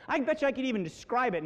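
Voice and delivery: ordinary talk, nothing marked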